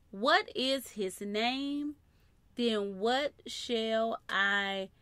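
drawn-out questioning read; slow scripture reading. Acted. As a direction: linger over each word. Reading Moses' question slowly.

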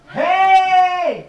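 high loud hey; surprise shout. Real, not acted. Barging in on the housemate, loud greeting.

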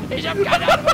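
schrill und laut in Panik; panischer Schrei. Real, not acted. Panik im feindlichen Auto. (panisch schreiend)

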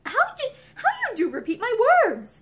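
testy. A narrator's reading. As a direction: bristle at being echoed. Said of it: "he objected testily".

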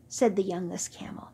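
ordinary delivery, nothing marked

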